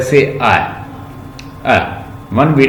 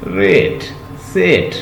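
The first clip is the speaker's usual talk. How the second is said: ordinary talk, nothing marked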